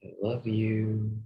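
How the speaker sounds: ordinary delivery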